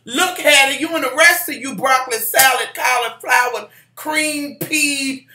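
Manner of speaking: high, loud, exasperated scolding with food words for insults; mock telling-off. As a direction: tell her off, playfully over the top. Acted telling-off of church member.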